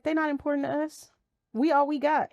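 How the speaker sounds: lightly voiced daughter, pitched high; imitated insistent child. Mother retells daughter severing people, imitated insistent child.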